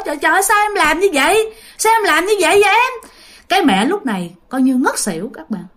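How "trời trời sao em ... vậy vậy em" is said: higher, raised voice of dismay; husband scolding his wife in shock, pitch climbing; upset scolding. Retold or imitated. Father scolding mother after the miscarriage, upset cry. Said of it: "Ba la quá".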